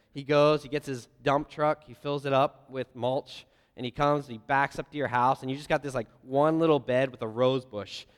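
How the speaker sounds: ordinary talk, nothing marked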